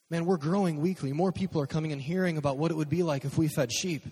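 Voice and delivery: high, loud, gushing excitement; hammed-up, breathless-with-enthusiasm rambling; over-eager report. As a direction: act out a hyped kid proudly reporting back. Acts Zach excitedly reporting to Jesus.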